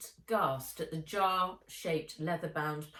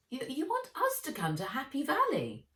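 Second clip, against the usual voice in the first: surprised; 9 semitones higher than usual. Acted. Surprised stammering question.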